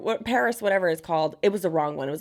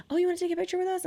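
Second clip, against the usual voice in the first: high, sugary come-on, much higher pitch; sweet showgirl voice; 12 semitones higher, much faster than usual. Retold or imitated. Showgirl luring tourists, high sugary voice. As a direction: lure tourists in with a high, honeyed offer.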